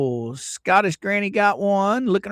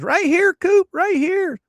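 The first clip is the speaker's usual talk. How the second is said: high quoted voice; 12 semitones higher, 4 dB louder than usual. Retold or imitated. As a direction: squeak it like an excited fan. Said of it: "She says".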